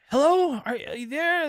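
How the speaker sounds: hammed-up high caller voice; mock caller voice. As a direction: squeak it out like a frantic stranger. Caller voiced high in retold call.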